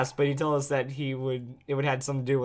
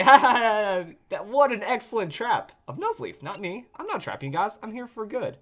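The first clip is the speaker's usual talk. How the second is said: laughing it off in a higher voice; mock laughing villain; 6 semitones higher than usual. Explaining away his own trap to the player, mock laughing. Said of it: "now he's like, laughing at himself".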